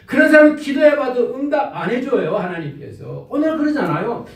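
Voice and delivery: usual speaking voice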